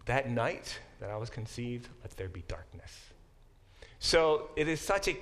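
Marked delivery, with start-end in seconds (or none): 0.1-2.9 low, hushed lament, fading away; Job's refusal. Acted. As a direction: sink softly into a dark curse. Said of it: "And Job says".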